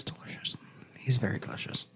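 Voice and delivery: low, hushed, admiring whisper; whispered aside. Real, not acted. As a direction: drop into a whisper, as if confiding. Swooning aside about a tall officer.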